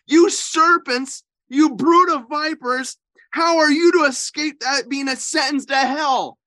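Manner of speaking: ringing prophetic condemnation; preaching the woes. Acted. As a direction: thunder each woe at the hypocrites. Reads the woes aloud.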